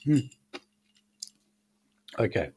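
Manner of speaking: talking through a mouthful; chewing while speaking, mouth full. Real, not acted. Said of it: "Are you eating cereal?".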